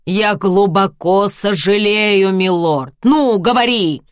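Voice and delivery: loud, high, slow mocking shout; harsh barking commands. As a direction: force the words out, furious. Husband forces apology, shouting.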